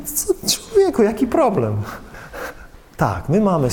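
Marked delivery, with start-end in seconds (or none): 0.0-1.8 high, breezy what's-the-problem voice; God's brushing-off reply. Acted. God waving off Moses' excuse in a high, breezy voice. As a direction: wave away the excuse with a light high question.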